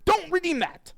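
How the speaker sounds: high, mock-stern warning; mock scolding. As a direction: wag a finger at chat in a silly voice. Scolding chat about redeeming.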